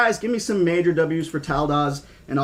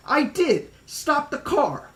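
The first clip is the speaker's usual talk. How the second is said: put-on high, slow child voice pleading; high kid begging to stop; insistent kid; 10 semitones higher, slower than usual. Tim insists on stopping.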